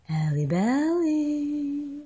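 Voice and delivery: very slow rising crooned "Halle Belly"; sing-song nickname. Real, not acted. Mother crooning a pet name to baby.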